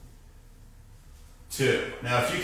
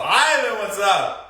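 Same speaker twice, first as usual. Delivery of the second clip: excited shoutout; 7 semitones higher, 5 dB louder, slower than usual. Real, not acted. Shouting out another viewer.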